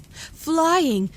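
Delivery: high, loud, joyful guess; joyful shout. A narrator's reading. Edith shouts her guess at the girl's charades, joyfully. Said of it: "Edith shouted joyfully".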